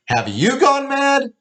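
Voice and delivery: very high, slow sneering echo; mocking shout back. Father shouting her question back at mother. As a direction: hurl her words back at her.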